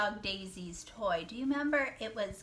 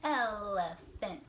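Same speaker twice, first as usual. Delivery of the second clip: "Elephant" slowly split into el-e-phant; syllable by syllable; 3 semitones lower than usual. Shown as a demonstration. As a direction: break "Elephant" into three slow, separate beats. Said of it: "Try it one more time".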